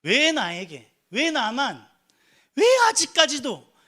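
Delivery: loud, high cries of "why me?"; anguished protest. Acted. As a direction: cry out in anguish, each question pushed out loud. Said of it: "정말 괴로워요". (괴로움에 차서 높고 크게 따져 묻는 외침)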